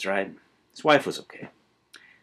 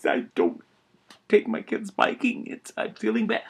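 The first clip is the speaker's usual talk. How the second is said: higher, half-hammed tearful voice; mock tearful; 10 semitones higher than usual. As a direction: play at choking up over the kids. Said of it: "I'm a tearing up".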